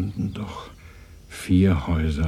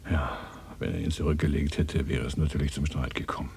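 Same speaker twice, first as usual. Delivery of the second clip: ordinary talk, nothing marked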